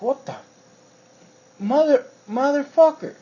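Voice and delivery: high, loud shocked curse; shocked his cigarette vanished; outraged disbelief. Retold or imitated. Finding his cigarette stolen from the break room, outraged.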